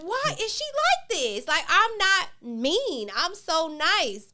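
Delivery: high, slow, wounded disbelief; exasperated mom. Retold or imitated. Mother baffled by her moody teenage daughter, slow high whine. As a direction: drag it out, baffled and hurt. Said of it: "And I'm like".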